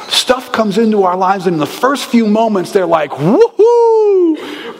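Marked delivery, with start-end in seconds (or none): 3.1-4.8 high, loud excited cheer; excited woohoo. Retold or imitated. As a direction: whoop like a thrilled kid. Excited reaction to new stuff.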